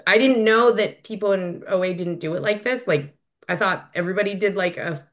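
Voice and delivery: ordinary delivery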